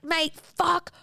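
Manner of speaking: high, drawn-out mock yell; scream-like pitch at moderate volume, easing off; retold screaming. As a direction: stretch it out as an imitated scream. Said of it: "like, screaming at him".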